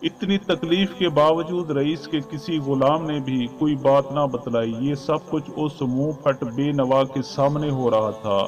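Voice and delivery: usual speaking voice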